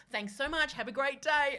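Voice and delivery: high, loud customer-service sweetness; over-cheery farewell. Retold or imitated. Bookseller waving off the schooled customer, exaggeratedly cheery. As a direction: pile on the gracious shopkeeper act.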